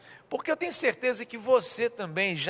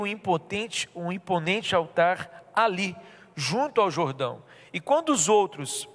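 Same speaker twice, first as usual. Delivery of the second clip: scripture reading; 3 semitones lower than usual. Acted. Pastor reading Joshua to the congregation, measured reading. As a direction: read the passage clearly.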